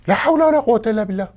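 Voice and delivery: fed up and loud; exasperated. Acted. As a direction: cry out at the end of your patience.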